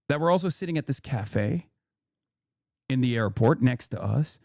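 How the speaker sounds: normal talking voice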